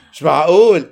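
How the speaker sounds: loud stunned disbelief; can't believe it, said loud; shocked disbelief. Real, not acted. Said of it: "أنا مصدوم". (صدمة وعدم تصديق)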